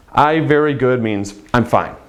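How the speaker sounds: ordinary delivery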